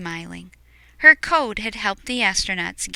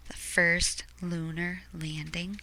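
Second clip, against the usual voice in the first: hushed dramatic whisper; storyteller's suspenseful whisper; 3 semitones lower, 10 dB quieter than usual. A narrator's reading. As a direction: breathe it like a secret.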